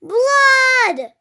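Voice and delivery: high, loud, drawn-out cry of alarm; storybook shriek, falling at the end; mother's yell. A narrator's reading. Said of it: "Loretta's mother yelled".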